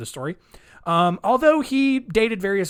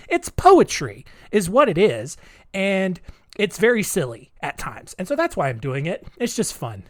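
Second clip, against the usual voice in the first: usual speaking voice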